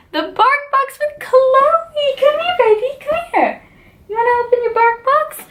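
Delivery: very high sing-song voice for the dog; high puppy-talk coo. Real, not acted. Talking to her dog Chloe.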